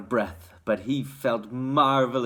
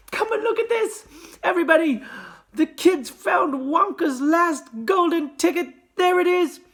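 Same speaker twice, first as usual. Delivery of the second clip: excited shouting; 5 dB louder than usual. A narrator's reading. Shopkeeper shouting joyfully.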